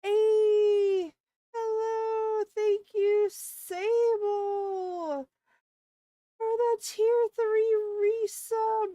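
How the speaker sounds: loud, high, bubbly shout of thanks to a subscriber; excited thanks. Real, not acted. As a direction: cheer the viewer's resub with big energy.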